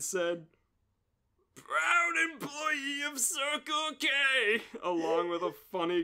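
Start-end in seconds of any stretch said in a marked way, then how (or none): 1.5-4.6 high, slow and proud proclamation; proud announcement. Acted. Reading the shirt slogan aloud, high and slow.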